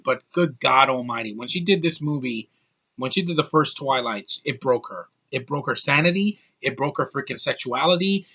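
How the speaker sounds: usual speaking voice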